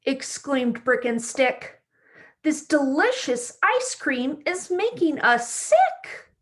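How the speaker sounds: bright, lively storybook read-aloud; reading to children. A narrator's reading. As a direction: read it like story time for little kids. Reads picture book to children.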